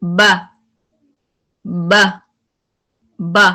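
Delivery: firm, clipped बा with the breath stopped; Arabic letter बा demonstrated strongly. Shown as a demonstration. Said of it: "जब आप बा कहते हैं ना, अब आपकी आवाज हाई हो रही है".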